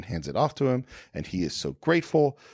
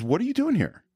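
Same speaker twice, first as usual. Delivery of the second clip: questioning officer, pitched much higher; pointed question; 12 semitones higher than usual. Retold or imitated. The officer asks the stranger why he's here, sharply and much higher. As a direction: press him with a sharp, high question. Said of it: "the next thing he asks is".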